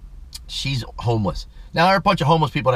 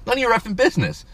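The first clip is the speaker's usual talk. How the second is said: higher-pitched retort of what he only thought; mock indignant retort; 11 semitones higher, faster than usual. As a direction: throw it back high and indignant. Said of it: "but I'm thinking".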